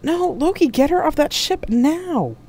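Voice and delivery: high, urgent shout, drawn out at the end; desperate order. A narrator's reading. Jack ordering Loki to get her off the ship, desperate shout. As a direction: yell the command in high alarm.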